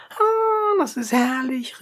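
high, delighted exclamation; joyful cry. A narrator's reading. Ladies of Abdera cheer the idea, high and delighted. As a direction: squeal with joy, jumping up. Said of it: "riefen die Abderitinnen alle vor Freude aufhüpfend".

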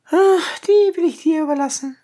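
high, snippy brush-off. A narrator's reading. Young lady snaps back at a mocking rival, snippy and high. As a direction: toss it off sharply, a touch high. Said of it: "sagte jene schnippisch".

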